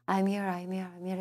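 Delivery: "I'm here" repeated quick and blurred together; fast drill. Shown as a demonstration. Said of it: "빠르게 지나갔기 때문에".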